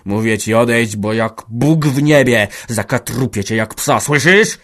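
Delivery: loud, menacing shout rising on "słyszysz"; father shouts a death threat at his son; threatening shout. Acted. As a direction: threaten to kill him, loudly. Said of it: "krzyknął znów stary".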